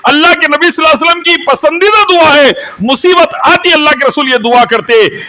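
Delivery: high, forceful preacher's delivery; impassioned preaching. Real, not acted. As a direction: cry out the prayer to the congregation. Preaches on prayer in hardship.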